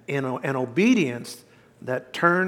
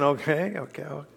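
resigned muttering; 2 semitones lower, 8 dB quieter than usual. Retold or imitated.

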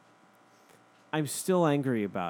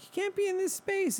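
much higher put-on voice; squeaky lady. The lady ordering the driver, squeaky. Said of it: "her voice was very like this".

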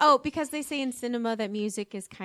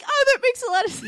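higher, louder mock delight; delighted realization; 12 semitones higher, 8 dB louder than usual. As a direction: play the thrilled listener who finally gets it. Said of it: "I was like".

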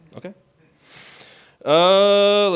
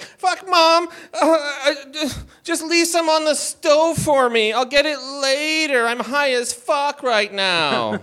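put-on slow, higher stoned teenager voice; stoner teen; 7 semitones higher, slower than usual. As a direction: drawl it out like a high teen. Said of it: "I, like".

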